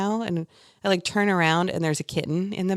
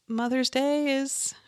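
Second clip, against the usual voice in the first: wry high quip; 8 semitones higher, slower than usual. Acted. Wry joke about working holiday.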